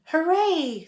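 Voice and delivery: high, loud team cheer; cheering scream. A narrator's reading. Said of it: "screamed the dream team".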